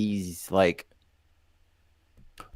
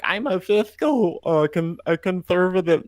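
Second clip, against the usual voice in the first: mocking voice; 7 semitones higher than usual. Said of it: "the people who are like".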